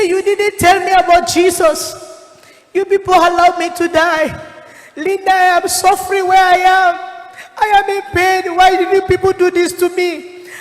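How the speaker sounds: high, loud retold lament; anguished reproach. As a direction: cry out the reproach as one in pain. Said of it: "as he was crying towards me".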